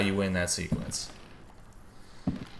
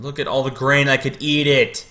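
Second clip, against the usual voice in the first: excited; 6 semitones higher, 8 dB louder than usual. Pretend. Hungry mock excitement over grain.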